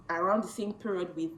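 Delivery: normal talking voice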